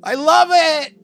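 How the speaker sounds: high gushing "I love it"; gushing delight. Retold or imitated. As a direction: gush like a thrilled fan. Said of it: "I was like".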